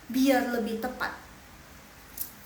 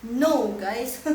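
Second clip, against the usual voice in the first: ordinary delivery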